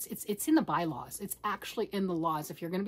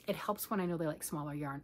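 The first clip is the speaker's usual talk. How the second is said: ordinary talk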